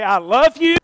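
slow, tender, earnest assurance; gentle encouragement. Retold or imitated. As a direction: lean in and say it kindly. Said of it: "come to him all the time and say".

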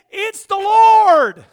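high, very loud, drawn-out cry; acted proclamation. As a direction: stretch LORD into a long shout. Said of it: "he said".

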